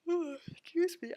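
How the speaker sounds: yawning. Real, not acted. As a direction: yawn through the words. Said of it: "I'm yawning today".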